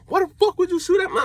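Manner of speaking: very high, fast imitation of her; mocking the woman's shrill complaint; mock girl voice. Mimicking the woman yelling.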